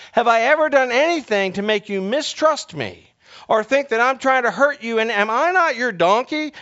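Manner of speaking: high, loud, imploring donkey voice; pleading donkey. Acted. Donkey pleading with Balaam who beats him, high and loud. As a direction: beg your master to remember you. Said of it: "he turned and said".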